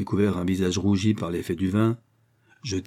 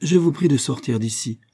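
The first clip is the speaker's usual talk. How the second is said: slightly hoarse, raised order; official's higher-pitched, lightly husky command; 6 semitones higher, 4 dB louder than usual. A narrator's reading. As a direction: order them out with a touch of hoarseness. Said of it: "prononça-t-il d'une voix enrouée".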